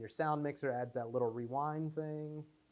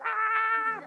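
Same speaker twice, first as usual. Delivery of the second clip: high, loud excited shout "Ah"; excited yell; 10 dB louder than usual. Retold or imitated. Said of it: "I remember yelling".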